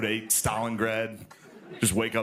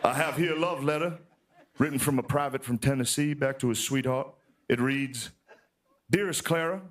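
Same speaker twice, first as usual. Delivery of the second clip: put-on old Southern historian reading a love letter; Shelby Foote. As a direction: read it with grave Southern romance, pausing between phrases. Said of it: "The only way I can think to get out of it is Shelby".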